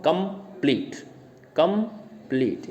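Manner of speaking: Complete broken with a gap, कं, then pleat; divided into two syllables. Shown as a demonstration. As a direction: say complete twice, each in two separate beats. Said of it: "दो में divide कर सकते हैं". (Complete को कं और pleat में बाँटकर)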